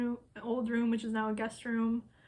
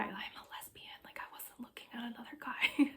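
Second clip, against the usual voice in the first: fast incredulous whisper; whispered disbelief; 7 dB quieter than usual. Retold or imitated. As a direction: hush it, baffled that anyone could think so. Her own retold baffled reply.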